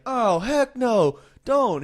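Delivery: high, loud and slow put-on wife; wife refusing the offer, loud high mock protest; loud mock wife refusal. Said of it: "she's like".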